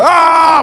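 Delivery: high, loud fake rage cry; mock angry yell. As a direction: ham up a furious lunge. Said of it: "I was like".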